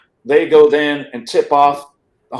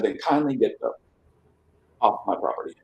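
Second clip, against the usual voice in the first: mock polite dismissal; 5 semitones lower, 8 dB quieter than usual. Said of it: "kindly, not really very kindly".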